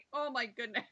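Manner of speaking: usual speaking voice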